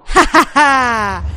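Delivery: loud mocking ha ha ha; taunting laugh. Real, not acted. Gamer mocking the dumb dogs, loud laugh. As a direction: cackle at the dogs you just fooled.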